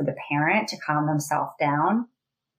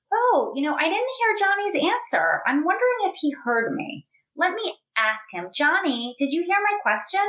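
put-on bright, much higher sing-song prompt to a child; high coaching voice; 11 semitones higher than usual. Shown as a demonstration. Parent prompting a child who missed the question, in a high sing-song voice. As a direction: ask the child gently in a lifted voice.

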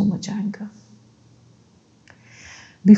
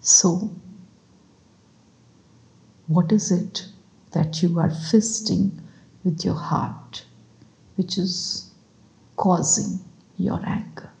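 calm, spaced-out phrases, each left to settle; guided meditation; 3 dB quieter than usual. Acted. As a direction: guide them gently through each breath, unhurried.

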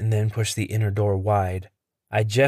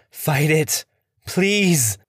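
high, raised desperate cry; desperate plea; 6 semitones higher, much slower than usual. Acted. Henry begging Smitty to fight it, high desperate cry.

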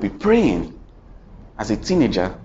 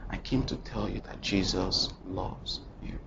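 tender quiet; 4 semitones lower, 12 dB quieter than usual. Retold or imitated. Tender message to suicidal man.

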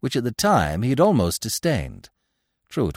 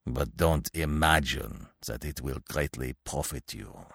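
low, soft, resentful menace; snarling threat; 5 semitones lower, 6 dB quieter than usual. A narrator's reading. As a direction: threaten quietly through bared teeth. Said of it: "showing his teeth like a snarling dog".

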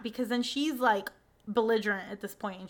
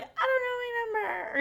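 high mock drunk girl voice; 12 semitones higher than usual. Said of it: "and she's like".

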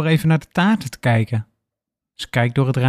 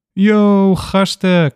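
louder, higher call from the hall; casual shout greeting; 7 semitones higher, 5 dB louder, much slower than usual. A narrator's reading. Tom greeting friends from the hallway, loud casual call. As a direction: holler a greeting to the gang.

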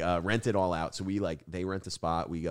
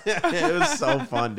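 much higher, laughing through it, slow; 9 dB louder, slower than usual. Real, not acted. Recalling the tailgate photo to friends, laughing.

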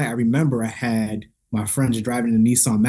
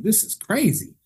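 amazed, pitched way up; retold amazement; 9 semitones higher than usual. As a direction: marvel in a high voice. Amazed reaction to talents, retold.

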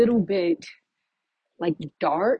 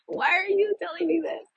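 laughing disbelief; 10 semitones higher than usual. Real, not acted.

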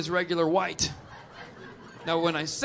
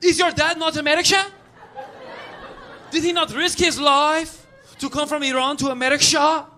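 put-on high, rising Persian-accented outrage; indignant Persian accent; 6 semitones higher than usual. Audience member defending the comic's dad, indignant Persian accent. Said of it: "There's no S H in America".

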